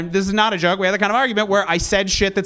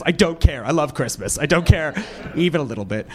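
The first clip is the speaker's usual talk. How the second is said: flat insincere delivery; 7 semitones lower than usual. Shown as a demonstration. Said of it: "an inability to inflect properly to indicate sincerity".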